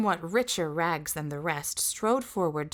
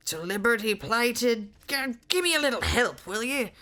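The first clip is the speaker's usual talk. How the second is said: giant pleading for help; 5 semitones higher than usual. Acted.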